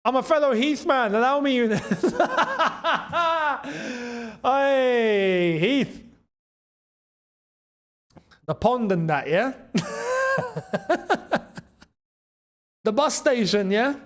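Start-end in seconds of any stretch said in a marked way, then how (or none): 1.6-4.4 real laugh. Real, not acted. Laughing at viewer's shout-out. 9.7-11.6 high-pitched laugh. Real, not acted. Laughing at local jokes.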